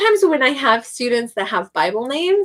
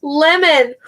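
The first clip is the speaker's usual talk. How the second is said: loud, high, presenting the fruit; announcing lemon; 6 semitones higher, 5 dB louder than usual. Shown as a demonstration. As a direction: hold it up and call it out. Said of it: "I add fruit to it and I say".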